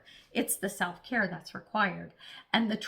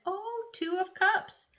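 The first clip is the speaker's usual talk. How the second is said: higher, slow delighted oh; pleased surprise; 10 semitones higher, slower than usual. Real, not acted. Tarot reader turning up the Two of Cups, delighted. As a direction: stretch the discovery as the card turns over.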